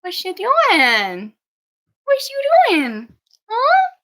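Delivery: high, loud playful coo. Real, not acted. Playing with Mocha in high, loud baby talk. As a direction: rev the puppy up with a high squeal. Cooing at puppy.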